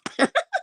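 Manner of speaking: high-pitched giggle; short high giggle; high squealing laugh. Real, not acted.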